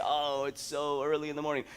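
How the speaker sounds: drawn-out mock grumbling; whiny put-on griping; mock whining. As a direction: imitate a morning complainer, dragging out the moans. Mimics negative morning thoughts.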